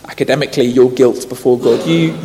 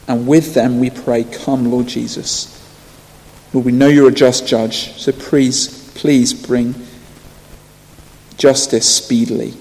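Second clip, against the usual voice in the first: closing prayer; 2 semitones lower than usual. Acted. Pastor leading the church in prayer, humble and earnest. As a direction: pray humbly for the congregation.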